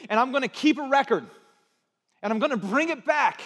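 loud, high, quick-fire scolding; mock accusing rant. Preacher playing someone who keeps score of wrongs, mock accusing. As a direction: act out a grudge-holder piling up accusations. Acts out a grudge-holder listing wrongs.

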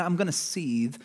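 mock inner seethe; quiet seething. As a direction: hold the anger in and keep it low. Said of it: "some of us are seethers".

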